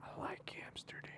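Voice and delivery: a conspiratorial whisper. Real, not acted. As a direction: breathe it like a secret. Joking aside about Amsterdam.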